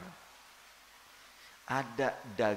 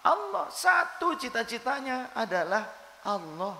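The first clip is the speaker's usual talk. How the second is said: high, loud, fervent sermon delivery; preaching at full force; 8 semitones higher, 4 dB louder than usual. Acted. Preacher rousing the congregation, preaching at full force. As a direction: lift the whole room, preaching hard.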